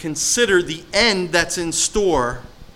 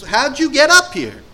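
high, loud confronting question; grandmother confronting; 10 semitones higher, 6 dB louder than usual. Retold or imitated. Grandmother confronting a man at her door, loud. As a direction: challenge the unwelcome visitor head on. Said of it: "She goes".

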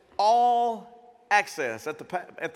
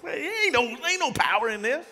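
mock despair; 6 semitones higher than usual. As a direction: ham up a deflated complainer. Mocking defeated believer.

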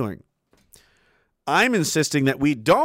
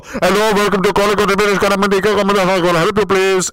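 mock call-centre agent; 2 semitones higher, 7 dB louder than usual. Mocking a call-centre agent greeting Canadian callers, loud put-on voice. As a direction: parody a scripted help-line agent with a put-on voice. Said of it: "didn't go".

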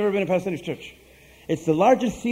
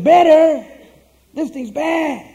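loud, high mock disbelief; incredulous outburst; 9 semitones higher, 8 dB louder, much slower than usual. Agent hears they want it better, acted incredulity. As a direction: burst out as if scandalised, acting it out.

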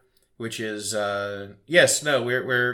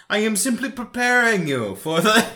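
slow theatrical villain menace; 8 semitones higher, 5 dB louder than usual. Retold or imitated. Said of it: "give him the villain voice".

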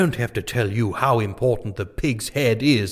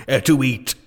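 ordinary delivery

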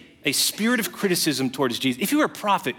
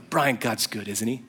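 softer, lower, smiling reassurance; gentle smiling friend; 5 semitones lower, 3 dB quieter than usual. Retold or imitated. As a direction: voice the kind mentor's soft cheer. Denny quoted smiling, gentle encouragement.

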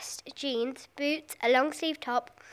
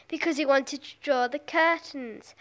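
plain reading voice

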